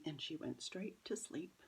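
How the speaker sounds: softly whispered secret; aunt whispering how the child went to sleep; whispered aside. Acted. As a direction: lean in and whisper it. Aunt confiding about the child.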